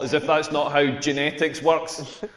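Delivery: ordinary delivery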